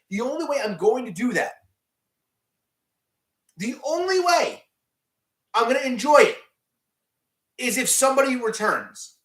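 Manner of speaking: exasperated imitation of a picky viewer; mock fan demand.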